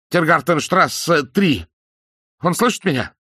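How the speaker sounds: high, loud urgent cry; panicked shout. A narrator's reading. Frightened man yelling the address into the radio. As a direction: shout it desperately.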